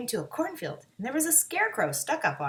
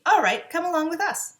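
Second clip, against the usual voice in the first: cheerful; 8 semitones higher, 7 dB louder than usual. A narrator's reading. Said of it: "said Dorothy".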